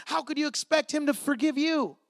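ordinary delivery

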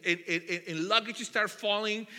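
ordinary talk, nothing marked

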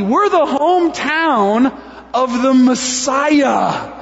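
excitement building to a loud, high boast; greedy delight. Acted. Townspeople claiming Jesus as their own, greedy excitement. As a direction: gloat over the windfall, stretching the big words. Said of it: "maybe it's a question out of greed".

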